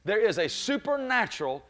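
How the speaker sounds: building sermon cadence; preaching build. Acted. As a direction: climb toward the shout.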